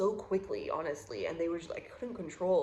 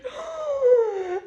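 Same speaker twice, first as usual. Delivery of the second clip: sharp gasp; 11 dB louder than usual. Retold or imitated. Recalling wave of guilt.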